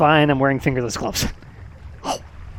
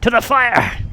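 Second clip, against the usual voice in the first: excited shout; 11 semitones higher, 5 dB louder, slower than usual. Real, not acted. Rushing food to the fire.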